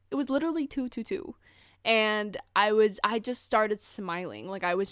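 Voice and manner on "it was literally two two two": fast excited repeat. Real, not acted. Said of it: "It was two two two".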